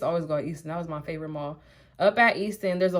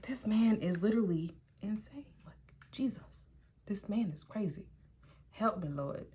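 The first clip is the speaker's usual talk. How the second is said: breathless unvoiced whisper, much softer; whisper to self; 9 dB quieter than usual. Thinking to herself about the insane ex, whispered. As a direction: hush it like a scared aside. Privately reacting to crazy ex.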